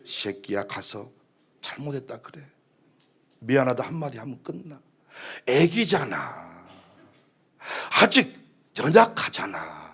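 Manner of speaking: low, hushed, patient urging; quiet coaxing. Acted. Holy Spirit coaxes pastor to apologize, hushed and gentle. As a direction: softly reason with a grown child.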